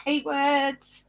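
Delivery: normal talking voice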